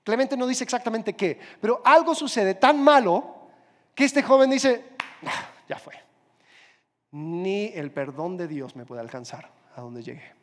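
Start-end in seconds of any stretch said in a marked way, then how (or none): 5.3-10.3 softly, almost whispered, like a man who has given up; despairing hush. Retold or imitated. Young thief gives up on God, in a near whisper. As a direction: let the words sink into hopelessness.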